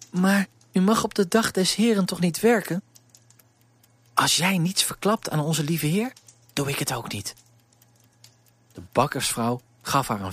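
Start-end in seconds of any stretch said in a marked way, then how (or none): 0.1-2.8 put-on higher, girlish voice, a bit timid; poor servant girl, lightly voiced; young girl Elise. 4.1-7.3 put-on sharing a secret with the girl; a hint of a sly, kind baker's wife; conspiratorial wink.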